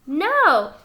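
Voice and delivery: ordinary delivery, nothing marked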